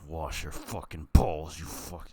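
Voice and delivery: stern self-command. Retold or imitated. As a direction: bark it at yourself slowly. Bossing himself in the shower.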